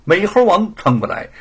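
ordinary delivery

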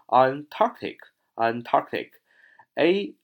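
Antarctic slowly, long stressed ARC; drawn-out Antarctic. Shown as a demonstration. Teacher modelling long vowel for class, drawn-out Antarctic. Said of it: "放长音".

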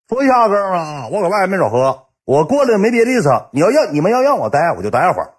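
high, hammed-up bluster; blustering drunk buddy. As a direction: imitate a tipsy big shot making his announcement. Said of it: "当时就五马长枪搁屋".

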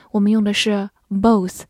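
ordinary talk

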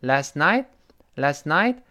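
"Last night" slowly, rising up at "night"; slow "last night" with a rise at the end; rising tune. Shown as a demonstration. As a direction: lift the voice on "night". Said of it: "night晚上一定是升调".

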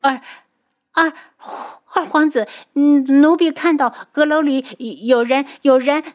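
high, stammering report in a fluster; panicked. A narrator's reading. Flustered maid reporting to the prince, panicked stammer. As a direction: stumble over the words in high-pitched panic. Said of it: "宫女就惊慌失措地冲了进来".

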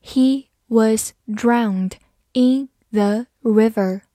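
slow and even, each word clear; slow reading. Shown as a demonstration. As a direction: spell it out slowly for the class. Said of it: "好，慢读一遍". (慢速逐词朗读)

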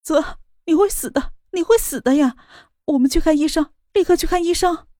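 high tearful pleading; crying. Acted. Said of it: "哭着道". (哭着哀求)

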